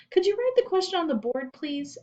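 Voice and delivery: put-on high, quick child's voice; a kid asking politely; small child. A narrator's reading. Child asks teacher.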